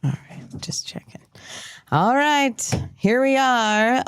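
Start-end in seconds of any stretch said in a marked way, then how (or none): 0.6-1.2 whisper. Real, not acted. Aside between host checks. 1.9-4.0 "All right" pitched much higher, falling on "right", as a show kickoff; high, upbeat host "All right"; bright show opener. Acted.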